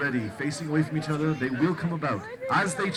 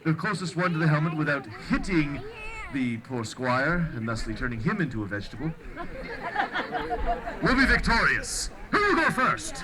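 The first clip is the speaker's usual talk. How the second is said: renaissance fair olde speech. Acted. Joust announcer, olde speech.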